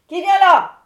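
high, very loud booming challenge; startled roar from a cave-dwelling spirit; booming wind lord. Acted.

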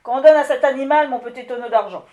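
very loud, commanding call to servants; the wind lord calling for his silver barrel; lordly command. Acted. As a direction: order the spirits about.

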